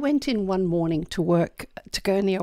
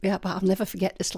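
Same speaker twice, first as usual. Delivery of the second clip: ordinary talk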